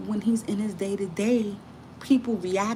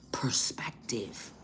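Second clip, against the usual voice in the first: whisper; 6 semitones lower, 8 dB quieter, much slower than usual. Shown as a demonstration. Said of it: "whispered word".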